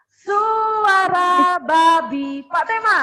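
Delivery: loud, high, slow announcement; calling out. Real, not acted. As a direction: call it out like a game host. Calling out animal sound turns.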